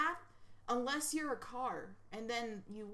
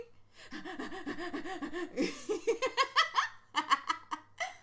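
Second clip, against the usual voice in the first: high, gasping imitation laugh; hyperventilating laugh; 6 semitones higher, 4 dB louder than usual. Shown as a demonstration. Streamer mimics sugar glider noise, hyperventilating laugh. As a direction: imitate someone laughing till they hyperventilate. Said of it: "laughs really hard and they're hyperventilating".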